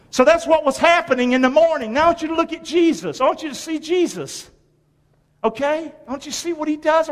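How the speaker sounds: high-pitched, urgent pulpit appeal; impassioned preaching. Real, not acted. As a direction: raise your pitch and press the congregation to pay attention. Preacher builds urgent appeal to congregation.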